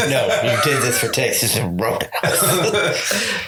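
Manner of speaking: giggling through a mock line; laughing through words. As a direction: laugh while delivering it. Mock quote while laughing.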